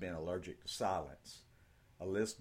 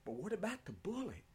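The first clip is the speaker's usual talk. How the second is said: put-on boy's voice; 11 semitones higher, 4 dB quieter, faster than usual. Boy Ben asks.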